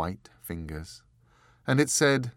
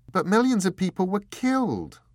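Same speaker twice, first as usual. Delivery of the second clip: much higher and louder outcry; horrified protest; 11 semitones higher, 7 dB louder than usual. Acted. Door protesting to the angel, high and loud in horror. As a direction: cry out against the slaughter.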